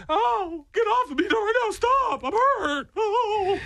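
very high-pitched hammed-up wail; mock crying. Fallen owner pleading with dogs to get off, mock crying. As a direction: whine in pretend pain, way up high.

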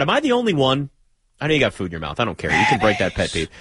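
normal talking voice

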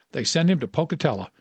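ordinary delivery

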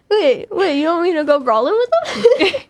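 high, loud, laughing objection; mock protest. Teammate joking about brawling with the Bulldogs, laughing mock protest. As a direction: plead in pretend disbelief, half laughing.

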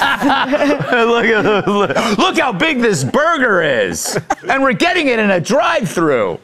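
high, drawn-out gushing wonder; mock amazement. Host mimics impressed tourist at a drive-thru, in mock amazement.

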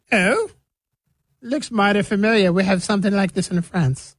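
high-pitched fake English gent; mock English accent. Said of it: "making the damn French sound like the damn English".

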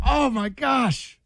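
high, drawn-out mock emotional gush; playfully overwhelmed, pitched well up; overcome by sentiment. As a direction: draw it out high, as if moved by a tender scene. Reacting to a tear-jerker children's book.

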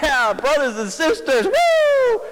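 high, whooping celebration; hammed-up preacher rejoicing with a whoop; mock revival cheer. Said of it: "Jonah's probably like".

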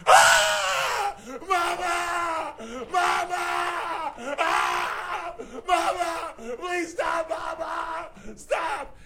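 mock terrified high-pitched wailing; imitated screaming for mama. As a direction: ham up a teen screaming for his mother on a drop ride. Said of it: "He's just screaming and crying".

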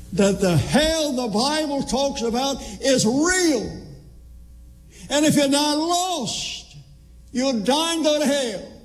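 high, slow preaching cry, pitch lifted well up; impassioned preaching. Acted. Preacher warning his congregation about hell, high drawn-out preaching cry. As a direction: lift the voice and drag each word like a warning from the pulpit.

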